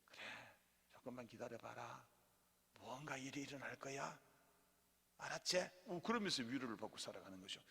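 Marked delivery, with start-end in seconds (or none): 0.1-5.7 God's gentle reply. Retold or imitated. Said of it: "하나님".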